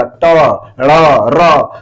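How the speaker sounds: "ट, ड़, र" one by one, tongue curled to the palate; each of "ट, ड़, र" sounded separately as a model; retroflex demo. Shown as a demonstration. Teacher models the sounds made at the palate, demonstrative retroflex. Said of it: "इस तरह के जो उच्चरित जो वर्ण हैं वो मूर्धा से".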